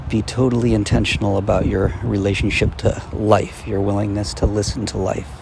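soft, quiet guiding voice; guided exercise. Acted. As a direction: lead them gently into listening. Leads a listening exercise.

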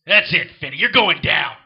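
loud angry threat. Acted. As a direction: shout the threat in anger. Threat after insult.

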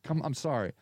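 ordinary talk, nothing marked

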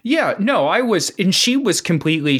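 ordinary talk

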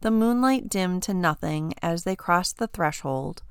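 plain reading voice

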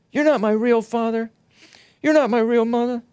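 high-pitched mock child's voice; child's protest. Adopted kid to parents, high mock protest. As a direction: whine it high, imitating a kid.

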